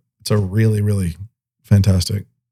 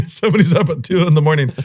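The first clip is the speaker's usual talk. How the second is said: higher, quicker, through real laughter; tickled and rushing it out; laughing; 6 semitones higher, faster than usual. Real, not acted. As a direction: repeat it while cracking up. Tickled, repeats own story idea.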